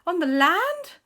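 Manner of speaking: high, loud, fast alarm; panicked queen. Acted. Queen panicking about the ring on land, high and loud. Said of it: "the Queen said".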